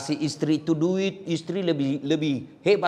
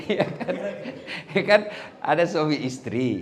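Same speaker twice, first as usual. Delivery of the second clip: normal talking voice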